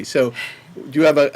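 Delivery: ordinary delivery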